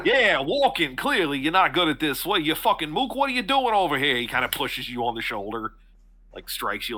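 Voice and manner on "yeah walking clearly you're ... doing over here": higher, aggressive jeer; hostile townsman. Acted. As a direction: get in his face and sneer.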